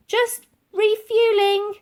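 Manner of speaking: high slow call to a distance. A narrator's reading. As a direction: call it across the lawn. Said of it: "called out Elsie".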